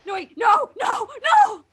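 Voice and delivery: shouted panic at the twist; panicked shouting. Real, not acted. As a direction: try to stop it.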